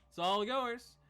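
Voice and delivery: high, loud call conceding the prize; elven man. Acted. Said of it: "the elven man says".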